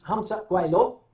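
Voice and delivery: imitated Chinese-sounding phrase; mock Chinese phrase. Shown as a demonstration. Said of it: "what I'm saying is".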